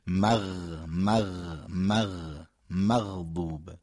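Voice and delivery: مغ repeated with a held throat gargle, مغضوب slow; gargled ghayn drill. Shown as a demonstration. Teacher drills the ghayn for listeners. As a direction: draw out the غ of مغ and مغضوب slowly.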